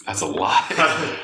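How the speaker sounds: high, slow, drawn-out lie; mock accusing. Calling out his friend's flattery, slow and high. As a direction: stretch it out teasing. Teasing the compliment.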